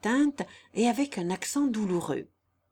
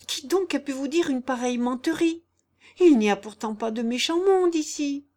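higher, louder, upset denial; tearful protest; 6 semitones higher, 6 dB louder than usual. A narrator's reading. Jeanne denying a lie to her godfather, tearful protest. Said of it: "suffoquée par des larmes soudaines".

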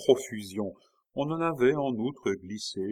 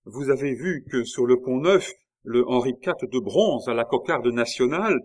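loud, raised-pitch question; bold challenge; 4 semitones higher, 7 dB louder than usual. A narrator's reading. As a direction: challenge the king, voice carrying. Biot boldly challenges the king.

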